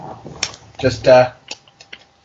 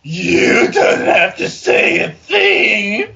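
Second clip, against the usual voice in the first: high, slow, laughing sultry line; mock seductive voice; 4 dB louder, much slower than usual. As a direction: try to be seductive but crack up. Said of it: "in the most seductive voice I can manage".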